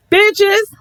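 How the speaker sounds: high, loud taunting yell; shrill taunting call; mock shriek. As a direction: fling it out like a taunt.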